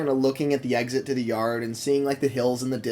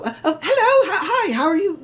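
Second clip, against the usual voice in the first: much higher, laughing and flustered; giddy greeting; 4 dB louder than usual. Acted. Mavis greeting Terrence, high and giddy. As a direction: starstruck and a bit giddy. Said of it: "Mavis is just like".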